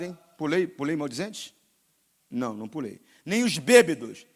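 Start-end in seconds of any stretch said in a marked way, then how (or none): 3.2-4.3 louder, slow thundering preacher; full-force sermon roar; fiery preaching. Acted. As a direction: hammer each sin from the pulpit.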